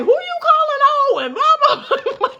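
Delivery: put-on a child's high yell, laughing; indignant squeaky yell. Retelling her twelve-year-old self yelling at the man who called her dad old, in a high laughing shriek. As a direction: shriek it out like an outraged kid.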